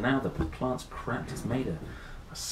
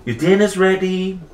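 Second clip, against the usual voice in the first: higher, louder call from the kitchen; 9 semitones higher, 10 dB louder than usual. A narrator's reading. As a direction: call across the house. Mother calls out.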